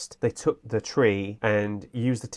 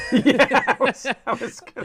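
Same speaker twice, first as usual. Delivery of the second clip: laughing; 14 semitones higher, 4 dB louder than usual. Real, not acted. Shared laughter.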